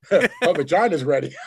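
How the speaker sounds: real amused outburst; laughing retort. Real, not acted. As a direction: throw the joke out while laughing. Joking retort amid laughter.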